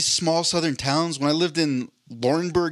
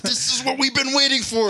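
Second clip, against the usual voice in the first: mock Montanan accent; 10 semitones higher than usual. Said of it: "I give them that accent".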